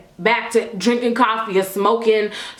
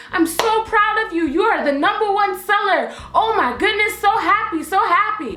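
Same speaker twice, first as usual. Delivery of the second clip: gushing boss praise, higher pitch; opgetogen lof; 7 semitones higher than usual. Retold or imitated. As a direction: gush with pride, pitched up. Boss gushing praise in retold story.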